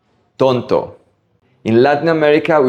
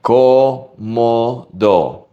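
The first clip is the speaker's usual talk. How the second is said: Cómodo stretched out very slowly, each O the same; much slower than usual. Shown as a demonstration. Teacher slowly modelling the same O sounds in Cómodo. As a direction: draw out every O in Cómodo evenly. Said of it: "Every O in this word sounds exactly the same".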